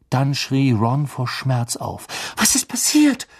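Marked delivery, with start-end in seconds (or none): 2.4-3.3 sudden high startled cry; jolted by a fright. A narrator's reading. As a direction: Hermione startled in the dark. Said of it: "sagte Hermine erschrocken".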